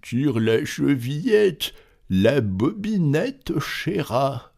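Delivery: wolf faking a gentle granny; wolf softening voice. Acted. Wolf in bed luring the girl, softened. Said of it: "en adoucissant un peu sa voix".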